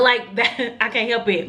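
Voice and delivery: normal talking voice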